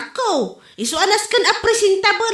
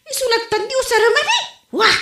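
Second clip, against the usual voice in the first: usual speaking voice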